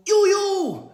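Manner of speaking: very high, loud echoing you, you; silly high-pitched birthday echo; squeaky echo. Pretend. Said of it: "And I'm saying".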